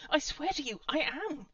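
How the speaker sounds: high, loud desperate insistence. A narrator's reading. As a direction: plead to be believed. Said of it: "he said desperately".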